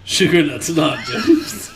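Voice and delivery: amused echo. Real, not acted. Co-host echoing a silly snack nickname back, laughing. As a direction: repeat it slowly, breaking into laughter.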